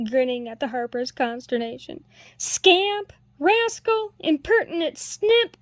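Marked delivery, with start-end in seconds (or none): 2.4-5.6 high, loud mock-angry scolding of a cheeky boy; a master yelling playful insults, louder and higher; mock scolding cry. A narrator's reading. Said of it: "cried the Harper".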